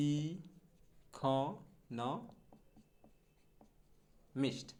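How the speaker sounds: ই, খ, ন, মিস্ট each alone with pauses; syllable by syllable. Shown as a demonstration. Teacher breaking down economist, ই খ ন মিস্ট piece by piece. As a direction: separate "i ... kho ... no ... mist". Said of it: "সঠিক উচ্চারণটা হবে এইরকম".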